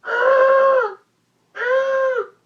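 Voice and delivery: two very high, loud shrieks; high-pitched roar of rage; enraged yells. Acted. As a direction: scream out a mock tantrum. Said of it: "he was so mad".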